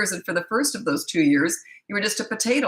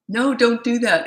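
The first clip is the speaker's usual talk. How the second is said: higher, louder anxious scolding; scared parent; 6 semitones higher, 5 dB louder than usual. Shown as a demonstration. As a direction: mimic a worried parent pleading no. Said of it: "makes you sound like you're scared".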